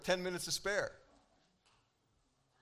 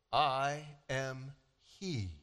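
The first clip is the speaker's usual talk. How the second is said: calm solemn declaration; 6 semitones lower, much slower than usual. Acted. Jesus quietly answers the officials.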